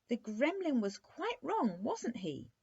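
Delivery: high-pitched, eager appeal; excited cry. A narrator's reading. Princess asking the prince about the gremlin, crying out. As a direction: cry it out high and lively, seeking agreement. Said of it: "she cried".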